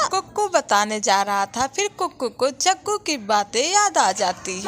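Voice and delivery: usual speaking voice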